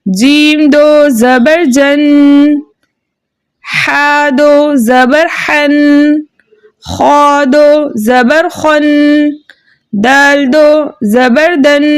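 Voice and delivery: slow, level chant with stretched syllables; spelling-out recitation. Shown as a demonstration. Teacher reads spelling and blend together for pupils, slow chanted drill. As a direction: draw out each syllable and hum the final n. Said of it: "जोड़ो रवा के साथ पढ़ते हैं".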